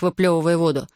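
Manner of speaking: ordinary delivery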